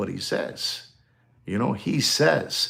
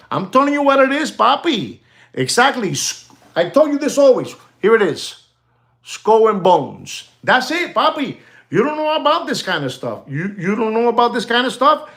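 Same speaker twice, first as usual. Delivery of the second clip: high-pitched, excited insistence; worked-up ranting; 10 semitones higher than usual. Real, not acted. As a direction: rant up high, insisting you were right all along. Worked-up rant at the listener.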